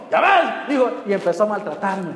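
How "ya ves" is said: taunting demon. Retold or imitated. Demon mocking the pastor.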